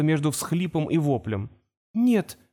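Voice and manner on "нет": high-pitched, frightened denial; distressed muttering. A narrator's reading. Girl denies what she sees, distressed. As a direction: refuse to believe it, voice pitched high. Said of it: "забормотала она".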